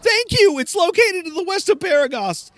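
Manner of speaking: very high, grateful chicken mage; high-pitched talking chicken; Mellencamp. Acted. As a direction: squawk your thanks and directions. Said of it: "my Mellencamp voice".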